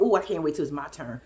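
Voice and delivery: low, quick mock-eager little voice; imagined eager sperm. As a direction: imitate it low and hurried, itching to go. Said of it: "swimming around talking about".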